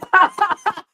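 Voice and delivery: loud high laugh; a sudden big laugh; burst of laughter. Real, not acted. Laughing as she recalls the cheering at landing.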